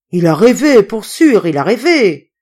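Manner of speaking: loud, agitated dismissal; loud disbelief. A narrator's reading. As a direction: shout the news down. Crowd loudly refuses to believe.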